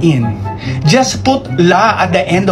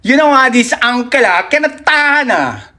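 full Singlish; 2 semitones higher than usual. Shown as a demonstration. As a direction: go all in on the local slang. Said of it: "my voice la, after one month".

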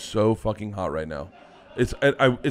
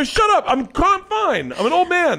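high, loud exasperated protest; mock defensive yell; 5 dB louder than usual. Retelling how he brushed partner off, high defensive yelling. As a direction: act out a worked-up protest, pitched way up. Retold defensive outburst about his teeth.

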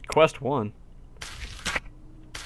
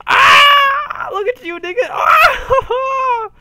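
startled shrieking laugh; 9 dB louder, much slower than usual. Real, not acted. Startled collision with player, laughing.

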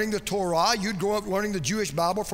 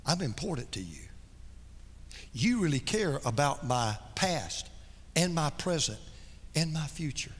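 tender inner voice; 7 semitones lower, 9 dB quieter than usual. Pretend. Preacher voicing a sinner who feels loved by Jesus, tender and hushed. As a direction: speak it like a quiet realisation of being loved.

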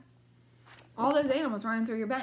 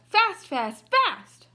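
each fast higher and louder, last one nearly shouted; building excitement; 9 semitones higher, 8 dB louder, much slower than usual. A narrator's reading. Reading the chase to children, rising to a shout. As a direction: ramp up to a big final fast.

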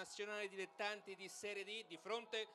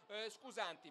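ordinary talk, nothing marked

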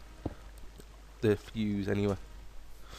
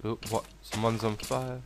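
normal talking voice

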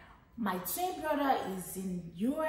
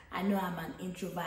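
usual speaking voice

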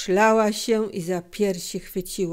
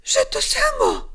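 high, loud, agitated protest; strained, high-pitched exclamation; crying out; 7 dB louder than usual. A narrator's reading. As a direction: cry out SAMO in outrage. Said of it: "krzyknęła".

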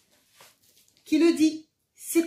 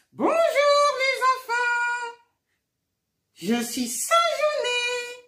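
puppet voice; 10 semitones higher, 4 dB louder than usual. Acted. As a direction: greet the children as an excited, giggly puppet. Voix de marionnette, Saint-Genès. (voix aiguë et lente de marionnette joyeuse)

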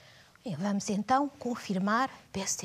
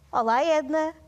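bright hello called louder and higher; calling out; 6 semitones higher, 6 dB louder than usual. Real, not acted. Teacher hails Edna to start the activity, calling out. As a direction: call over a cheery greeting, raised in pitch and volume.

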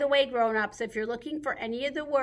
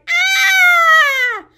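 long high loud "Aah!" scream; mock scream; 17 dB louder than usual. Farmer's wife jumps on the table at the mice, hammed-up scream. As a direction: scream it out, drawn-out and piercingly high. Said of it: "screamed for her life".

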